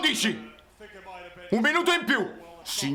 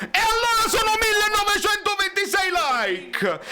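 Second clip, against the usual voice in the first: tearful joy; 7 semitones higher than usual. Real, not acted. Celebrating the win.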